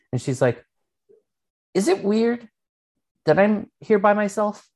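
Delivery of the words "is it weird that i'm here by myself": lightly voiced nervous young girl, higher; a hint of a shy girl asking; mild girlish worry. Girl voiced in retold story.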